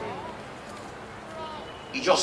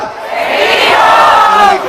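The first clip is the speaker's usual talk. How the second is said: very slow, much higher, louder cry; drawn-out shout; 13 semitones higher, 11 dB louder, much slower than usual. Real, not acted. Preacher rouses the congregation to continue, shouting. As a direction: stretch it into a rousing shout. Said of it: "Dígame si seguimos o paramos".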